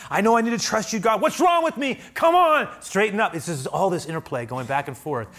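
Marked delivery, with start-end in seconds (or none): 0.1-3.3 very high, loud exasperated self-talk; scolding oneself. Acted. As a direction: give yourself an exasperated pep talk.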